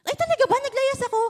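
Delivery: high, startled disbelief at learning she ran away; mock-startled ay, talaga ba; surprised. Told to her father about running away, she answers with high startled disbelief. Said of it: "Sabi ko".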